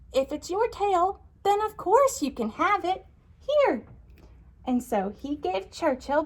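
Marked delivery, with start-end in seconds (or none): 0.1-3.8 very high, gentle bird voice; kind bird voice. Acted. Bird giving Churchill his tail back, high and kind. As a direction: hand it back sweetly as the little bird.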